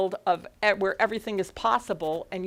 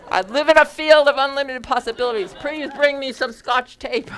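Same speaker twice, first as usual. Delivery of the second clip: loud, high comic invocation; belted-out mock prayer, raised pitch; mock loud affirmation; 6 semitones higher, 11 dB louder than usual. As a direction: call it out like a joking plea to heaven. Mock-prays loudly for Scotch tape.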